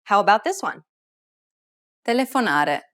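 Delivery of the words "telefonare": "Telefonare" stressed on "na"; penultimate stress. Shown as a demonstration. Teacher revealing a quiz answer, second to last syllable stressed. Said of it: "the second to last syllable".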